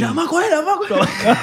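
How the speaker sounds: agudo entre carcajadas; riéndose. Real, not acted. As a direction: se le escapa la risa al decirlo. Joking, bursting into laughter. (riéndose a carcajadas)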